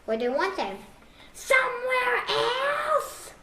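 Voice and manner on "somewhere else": much higher, slow drawn-out wail; exasperated cry. Acted. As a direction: stretch it out in despair. Gerald exasperated about his head.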